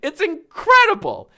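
loud, high and delighted; delighted outburst. Real, not acted. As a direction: exclaim it at full voice after cracking up. Cracks up after own impression.

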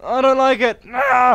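loud, high tantrum, building to a yell; angry complaint. Pretend. Player hating inverted controls, angry rising protest. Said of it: "I'm getting too angry".

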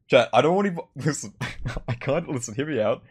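ordinary talk

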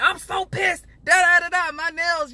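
high, loud exaggerated squeal of outrage; squealing mock rage, loud and high; mock angry rant. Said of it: "I'm like".

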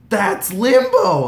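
loud, high, stretched-out fangirl cry; reliving the thrill of spotting an idol; excited cry. Retold or imitated. Fan spots idol, reliving it.

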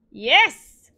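loud, high burst of triumph; triumphant yell. Real, not acted. Player as the cow finally moves, triumphant yell. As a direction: yell it as a sudden win.

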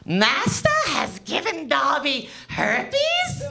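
slow, squeaky house-elf voice; Dobby impression. Comic doing Dobby for the crowd, slow squeaky elf voice. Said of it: "here's my impression".

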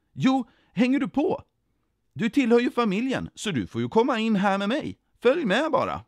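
high, loud, swooping enthusiasm; eager invitation. A narrator's reading. Jesus bjuder in familjen, eager and high. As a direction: urge them cheerfully to come along.

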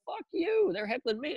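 high-pitched, indignant retort; retold outburst, voice leaping up without shouting; losing it. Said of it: "I lost it on him now".

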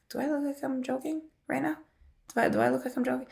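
high-pitched, softly incredulous retort; quiet, high mock disbelief. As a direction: imitate a disbelieving comeback, pitched well up. Retold incredulous reply to boyfriend.

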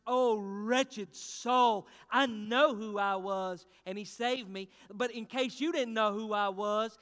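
loud, slow, hammered testimony; full-force preaching. Acted. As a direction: preach it like a revival.

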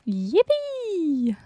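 high, drawn-out yippee; gleeful cheer. Real, not acted. Player celebrating during the hunt, gleeful cheer. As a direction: let out a silly, squealed cheer.

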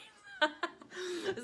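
bright burst of laughter; a quick laugh after the punchline; real laugh. Real, not acted. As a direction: laugh at your own joke. Laughs at own joke.

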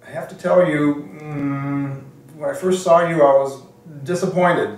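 high, loud mimicry with hums and halts; Mike Jacobstein. Mentor confesses to visiting applicant, high halting mimicked voice. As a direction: imitate him, pitched well up, pausing before the blow. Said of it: "he speaks like that".

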